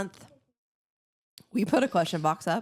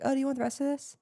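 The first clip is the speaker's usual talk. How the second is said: high, innocent offer; clueless offer; 7 semitones higher, faster than usual. Retold or imitated. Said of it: "clueless and was like".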